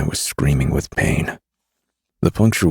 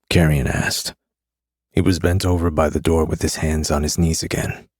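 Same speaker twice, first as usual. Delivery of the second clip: plain reading voice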